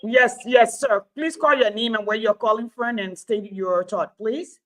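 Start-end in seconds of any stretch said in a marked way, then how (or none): none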